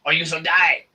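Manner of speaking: loud, menacing dark lord threat; scary voice. Acted. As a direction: threaten like Emperor Palpatine. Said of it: "I can't do it for very long, obviously, 'cause it hurts my, my throat face".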